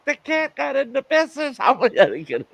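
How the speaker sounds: high, panicky imitation of children; mock child voices in a high-pitched panic; kids freaking out. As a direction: squeal like alarmed kids. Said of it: "the kids are freaking out".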